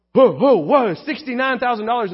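loud mock-shocked interruption; alarmed objection. Deacons stopping their colleague over the price, acted alarm. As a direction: halt him with three punched whoas. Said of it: "we all went".

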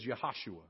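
"Yahashua." said yah-hah-SHOO-ah; "Yahashua." spoken as Hebrew, yah-hah-shoo-ah; Hebrew name. Shown as a demonstration. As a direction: give "Yahashua." its Hebrew sound. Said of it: "In Hebrew".

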